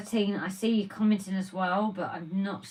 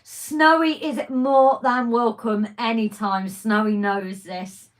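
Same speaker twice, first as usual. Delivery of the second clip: emphatic welcome; 9 dB louder, slower than usual. Real, not acted. Reassuring absent friend.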